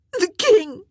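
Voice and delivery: loud, high wailing cry; anguished, tearful outburst; bursting into tears. A narrator's reading. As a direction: blurt it out and burst into sobs. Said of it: "burst out in fresh floods of tears".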